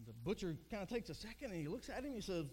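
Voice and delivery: ordinary talk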